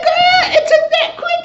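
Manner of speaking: loud, high, laughing shriek; shrieking disbelief. Retold or imitated. Reacting to how fast she gave in, shrieking laughter. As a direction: scream it out in disbelief. Her own shocked reaction.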